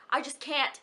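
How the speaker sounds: normal talking voice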